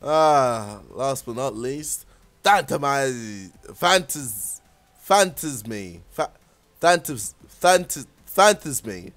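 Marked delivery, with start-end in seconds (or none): 2.4-9.1 stumbling over "Phantasme", restarting halfway through; halting, broken attempts at "Phantasme"; failing to pronounce. Real, not acted. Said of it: "I can't say that word".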